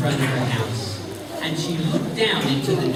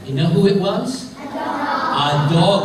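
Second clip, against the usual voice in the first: ordinary delivery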